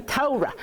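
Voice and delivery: Torah said TOH-ruh; stress on first syllable. Shown as a demonstration. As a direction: punch the opening TOH of Torah. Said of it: "it's hard to say it any other way".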